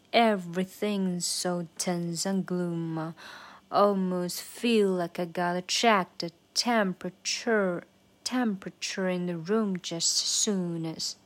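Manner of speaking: slow, drawn-out verse demo; slow version. Shown as a demonstration. Teacher walking learners through the verse, slow and deliberate. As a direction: stretch each stressed word out slowly. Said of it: "慢速版".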